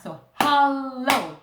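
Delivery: "hollow" said very slowly and loudly, split between the two L's; slow syllable split. Shown as a demonstration. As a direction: stretch out "hollow" into its two halves. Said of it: "split in half".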